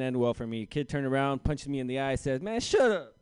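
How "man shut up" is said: loud, high dismissive snap; high and loud schoolyard put-down; mock tough kid. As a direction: brush him off like a bully. Kid punches him.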